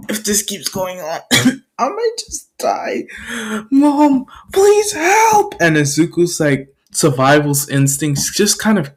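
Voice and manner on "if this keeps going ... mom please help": small high crying voice; acted tearful pleading. Beaten child begs for mother.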